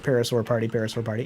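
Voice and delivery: quick, low repeats of a tongue twister; fast tongue twister. Shown as a demonstration. Streamer testing a silly phrase on viewers, said fast. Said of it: "Say that too many times fast".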